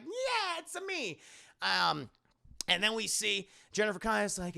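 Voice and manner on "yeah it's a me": very high squeaky Mario impression. Hulk pops out of the ground, squeaky Mario voice. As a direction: chirp it out like a cheery cartoon plumber. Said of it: "like from Mario or something".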